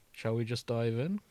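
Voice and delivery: usual speaking voice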